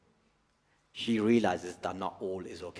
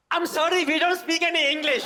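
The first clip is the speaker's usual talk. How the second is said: put-on much higher, louder little-girl voice; child's innocent act; 8 dB louder than usual. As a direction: squeak it out as the clever little niece. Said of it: "she goes".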